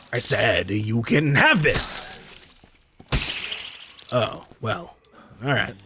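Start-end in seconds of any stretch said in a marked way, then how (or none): none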